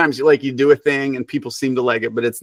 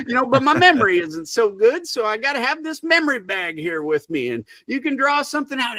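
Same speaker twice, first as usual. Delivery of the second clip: high-pitched Southern backwoods storyteller voice; high, hammed-up Southern twang; Backwoods Buttercup; 12 semitones higher than usual. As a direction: stay in the silly woodsman character, pitched way up. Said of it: "he has a Southern accent".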